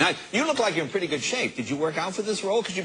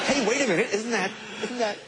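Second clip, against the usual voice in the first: words tumble out through a laugh, higher; laughing mid-protest; real amused laugh in the voice; 6 semitones higher than usual. Real, not acted. Host jokes about The Aviator.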